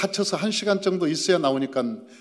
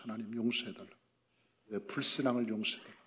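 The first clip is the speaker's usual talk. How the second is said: much softer pleading prayer; hushed prayer; 7 semitones lower, 13 dB quieter than usual. Retold or imitated. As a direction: beg forgiveness under the breath. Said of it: "기도를 했어요". (아주 조용히 용서를 구하는 기도)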